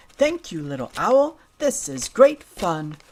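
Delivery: ordinary delivery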